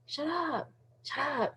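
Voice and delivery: hushed repeated command; soft shut up. Acted. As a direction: drop it down to a quiet hush.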